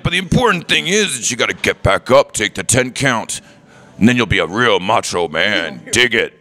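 high, growling wrestler promo voice; Macho Man Randy Savage. Retold or imitated. Fan retelling a favourite clip, as a hammed-up wrestler. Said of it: "clip of Macho Man Randy Savage talking".

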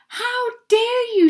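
high, loud outrage; angry shout. A narrator's reading. Soaked guest of honour confronts her sprayers, shouting angrily. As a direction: shout it indignantly, pitched right up. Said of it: "she shouted".

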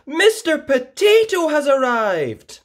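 high, loud thrilled cry; children spotting their hero, loud and high; excited announcement. A narrator's reading. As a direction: gasp it out with wonder. Crowd gasps, excited announcement.

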